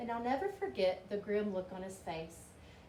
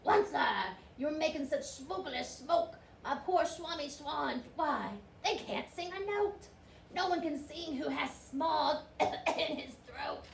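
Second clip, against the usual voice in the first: a hint of a croaking complaint, slowing at the end; mildly croaky Lorax protest; lightly croaky Lorax; 4 semitones higher than usual. A narrator's reading. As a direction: scold him through a sore throat. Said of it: "he cried with a croofless croak".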